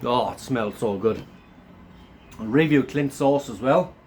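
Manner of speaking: normal talking voice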